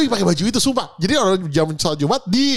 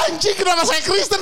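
high-pitched retold shock; kaget; 9 semitones higher than usual. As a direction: replay your stunned outburst, pitched way up. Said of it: "Gue shock". (kaget, suara melengking tinggi)

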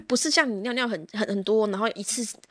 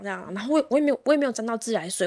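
ordinary delivery

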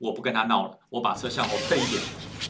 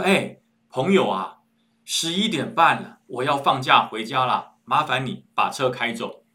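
slow strained patience; 4 dB louder, slower than usual. Retold or imitated. Forced patience after annoyance.

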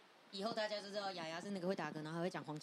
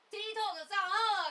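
excited; 9 semitones higher, 8 dB louder than usual. Real, not acted. Announcing news excitedly.

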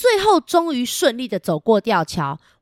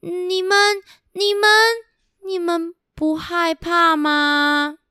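scared bunny; 9 semitones higher, slower than usual. Acted. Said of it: "小兔子又问了".

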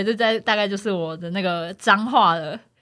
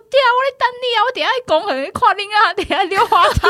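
playful driver; 9 semitones higher, faster than usual. Retold or imitated.

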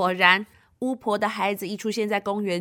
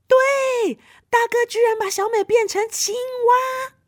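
high, loud burst of amazement; high-pitched astonished announcement; excited reveal; 13 semitones higher, 5 dB louder than usual. Acted. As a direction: exclaim the surprise answer to kids. Reacting to the right answer.

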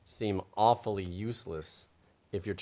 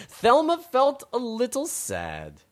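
storybook narration; 6 semitones higher, 6 dB louder, much slower than usual. Acted. Reads the storybook aloud.

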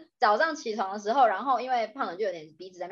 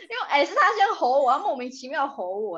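laughing; 4 semitones higher, 5 dB louder than usual. Real, not acted. Laughing defence of her teasing.

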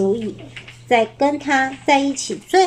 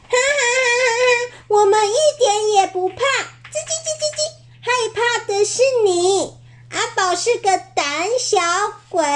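很高的妖怪尖嗓，边笑边唱快嘴取笑; 尖声哈哈大笑着嘲弄; 大声笑; 11 semitones higher than usual. Acted. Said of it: "小妖怪大声笑起来". (小妖怪大笑着嘲笑阿宝胆小)

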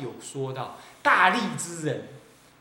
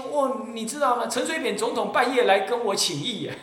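higher, louder swaggering boast; boastful bragger; 6 semitones higher, 4 dB louder than usual. Acted. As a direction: brag that heaven and dragons come. Monk mocks a self-praising cultivator.

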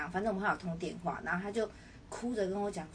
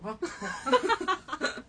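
high laugh; 7 semitones higher, 4 dB louder than usual. Real, not acted. Laughing at the friend's laundry excuse, high laugh. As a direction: chuckle at the absurdity.

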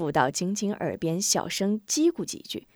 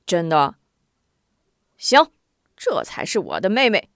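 put-on louder, delighted boy; pleased brother; 2 semitones lower, 6 dB louder than usual. Brother praises sister, loud and pleased. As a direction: glad she has agreed. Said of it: "吴中高兴地笑了".

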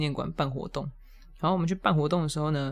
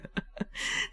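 breathy laugh; 8 dB quieter than usual. Real, not acted. Laughs at own mishap.

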